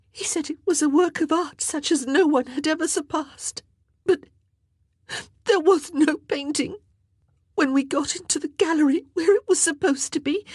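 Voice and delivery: high, tearful, gasping account; distraught crying voice, pitched very high; sobbing confession. A narrator's reading. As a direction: tell it through sobs, breaking for breath. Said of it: "she whispered through her sobs".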